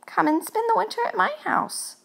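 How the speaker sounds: very high, eager ghost voice; ghostly invitation. Acted. Lonely ghost inviting the mouse home, high and eager. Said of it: "cried Gus".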